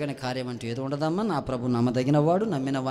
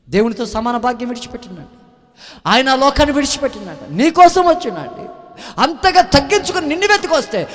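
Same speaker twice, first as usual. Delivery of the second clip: loud, high preaching climax, pressing the question; fiery preaching; 9 semitones higher, 12 dB louder than usual. Acted. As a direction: hammer each question at the congregation. Preaching at full force. (ఉద్వేగంతో గట్టిగా బోధించడం)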